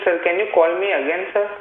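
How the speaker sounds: ordinary talk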